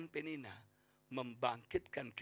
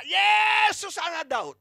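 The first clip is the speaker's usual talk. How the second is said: high, drawn-out loud call; shouted cry; 16 dB louder, much slower than usual. Retold or imitated. Preacher as blind Bartimius calling to Jesus, imitated shout. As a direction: yell out slowly over a crowd. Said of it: "Dia berteriak".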